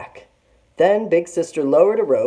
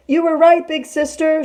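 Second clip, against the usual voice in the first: put-on desperate little girl; 14 semitones higher, 7 dB louder than usual. A narrator's reading. Girl's last words being eaten.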